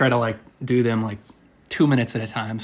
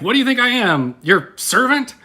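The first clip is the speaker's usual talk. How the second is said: high mock-offended retort; pitched-up rhetorical comeback; indignant joke turtle; 10 semitones higher than usual. Imagined second turtle refusing to serve, high mock indignation. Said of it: "you expect the second turtle to say".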